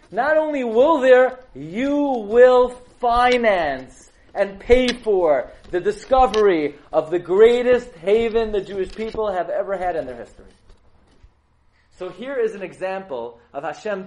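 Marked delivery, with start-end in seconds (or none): 0.1-10.5 much higher, much louder, slowed thunder; booming divine declaration. Acted. God declaring to Ferdinand and Isabella, booming. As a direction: proclaim it from on high.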